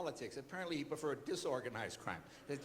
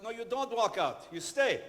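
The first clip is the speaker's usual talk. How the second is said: loud, higher, insistent correction; exasperated insistence; 5 semitones higher, 9 dB louder than usual. Retold or imitated. Director corrects the confused host, exasperated and loud. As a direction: lay down the instruction loudly. Director repeats instruction, losing patience.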